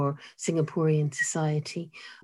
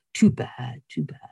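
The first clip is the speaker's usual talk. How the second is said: lightly voiced man, pitched low; a low, mock-commiserating aside; man across the table; 6 semitones lower than usual. Guy across the table to new father, low imitated commiseration. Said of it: "leans over and he says".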